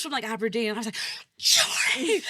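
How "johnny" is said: high loud call; urgent call for help. Retold or imitated. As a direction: shout his name across the office. Calling colleague across desks.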